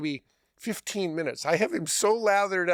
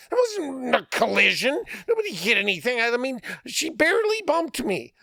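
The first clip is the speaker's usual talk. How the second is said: high, raised indignant friend; indignant protest; 9 semitones higher than usual. Retold or imitated. Friend insisting to the fake cop he's innocent.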